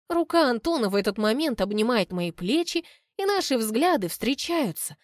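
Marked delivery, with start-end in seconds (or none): none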